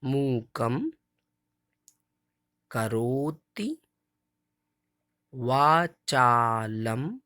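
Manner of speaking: each word slowly separated, वाचालम held long; slow model recitation. Shown as a demonstration. Teacher reciting each word slowly for children to repeat. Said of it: "एक बार हम बोलेंगे, दूसरी बार आपको बोल लेना है".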